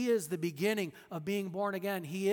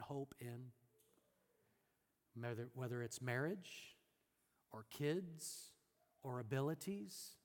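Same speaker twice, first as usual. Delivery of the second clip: much softer, close and earnest preaching; intimate sermon appeal; 4 semitones lower, 6 dB quieter than usual. Acted. Pastor appeals personally to the church, soft and earnest. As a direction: lean in and speak to each heart.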